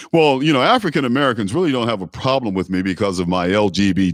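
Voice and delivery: glib politician's excuse, rattled off then spelled out; Pete Buttigieg. Retold or imitated. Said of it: "This dude, he's like".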